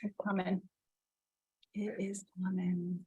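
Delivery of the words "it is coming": breathy whisper, no voice. Real, not acted. Host whispers her promise of the beach. Host repeats the affirmation softly.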